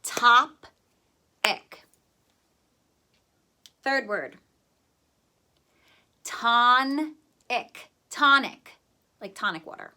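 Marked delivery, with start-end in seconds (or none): 0.0-1.8 "Top-ic" loud, long pause before ic; syllable by syllable. Shown as a demonstration. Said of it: "Second syllable says ick". 6.2-7.9 "tonic" split as ton, pause, ick; syllable by syllable. Shown as a demonstration. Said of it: "Do ton and then do ick".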